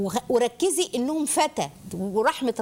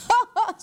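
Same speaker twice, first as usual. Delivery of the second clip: delighted laugh; 10 semitones higher, 4 dB louder than usual. Real, not acted. Teacher delighted by Ibrahim's answer.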